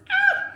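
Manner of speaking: sudden high, loud yelp; short high-pitched "ah!"; nervous squeal. Real, not acted. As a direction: let a nervous scream burst out. Nervous countdown to announcement.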